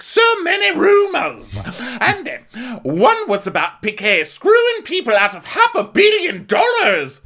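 hammed-up shrieky high put-on voice; Dorit. Mocking Dorit spreading rumors about P K, high loud exaggerated voice. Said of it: "Dorit's like".

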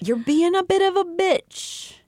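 high, teasing sing-song; high mock voice. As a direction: tease her in a high voice.

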